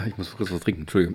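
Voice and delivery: hoarse after choking, very soft; catching breath after a cough; swallowed wrong way. Real, not acted. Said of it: "Hab mich verschluckt".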